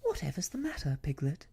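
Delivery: lifted pitch on a puzzled question; concerned surprise. A narrator's reading. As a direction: ask a friend what on earth is wrong. Boy asks frightened friend what's wrong.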